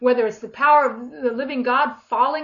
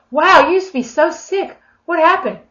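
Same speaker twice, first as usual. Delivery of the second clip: higher, louder astonished exclamation; amazed onlooker; 6 semitones higher, 10 dB louder than usual. Acted. Onlookers marvel at someone who was sick, amazed.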